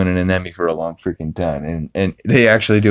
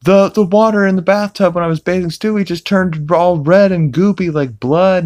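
panicked Meg; 11 semitones higher, 3 dB louder than usual. Retold or imitated. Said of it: "she's like".

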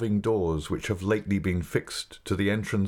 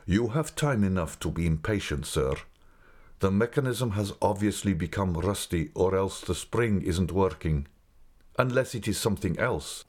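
plain reading voice

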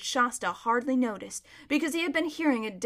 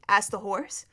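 ordinary delivery

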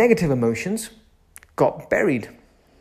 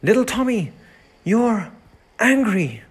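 put-on higher, tender voice for an upset toddler; soothing a child; 7 semitones higher than usual. Shown as a demonstration. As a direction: comfort little Tommy like a nursery teacher. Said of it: "angry, we say".

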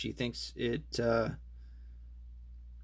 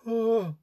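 gasp after whiskey; 12 semitones higher, 6 dB louder than usual. Real, not acted.

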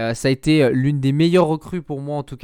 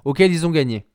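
normal talking voice

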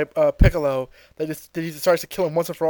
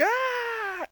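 high soft mock scream; a falling villain's scream, held back; 9 dB quieter than usual. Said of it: "he starts to scream".

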